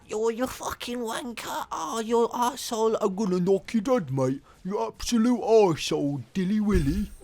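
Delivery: put-on a high, rowdy British pub accent; British bloke shouting at his mate in the pub. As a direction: play a drunk British bloke hurling insults in a pub. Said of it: "he sounds like a bloke in a U K pub".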